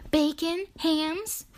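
bright high cheerful pig voice; chirpy and matter of fact. Acted. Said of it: "replied Pigwig cheerfully".

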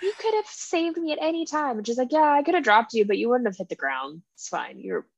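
ordinary talk, nothing marked

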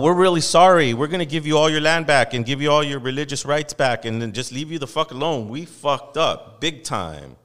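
loud, hammed-up apology; real apology. Host acting out a real apology with land returned, loud. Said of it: "Unless you're going".

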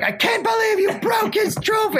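very high mock shriek of disbelief; imitating Holly's shocked shriek. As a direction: squeal it high and appalled. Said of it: "Holly's like".

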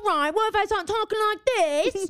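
high, fast put-on British boy voice; little British boy. Joking to a store clerk in a Hermes shop, high, fast little British boy voice. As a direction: play a little British boy showing off a new voice. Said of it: "Like a little British boy".

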